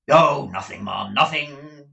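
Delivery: loud, slowing dismissal; sour, heavy emphasis; rough, bitter. A narrator's reading. Ralph answers madam about her husband, rough and bitter. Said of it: "in the rough and bitter voice".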